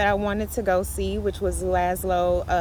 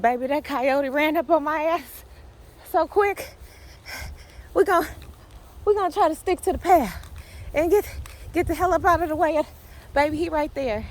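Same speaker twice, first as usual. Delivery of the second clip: real fright; 8 semitones higher than usual. Real, not acted. Coyote just ran up on her.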